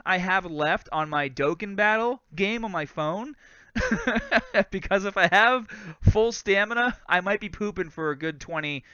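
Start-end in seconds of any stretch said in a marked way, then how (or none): 3.7-4.7 high giggle. Real, not acted. Laughs at poop stamina joke.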